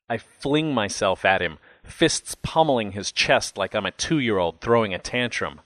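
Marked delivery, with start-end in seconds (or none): none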